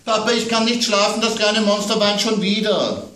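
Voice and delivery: put-on child's voice worried about monsters at bedtime; little girl. A narrator's reading. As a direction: play the small daughter scared of monsters. Child Amanda calls.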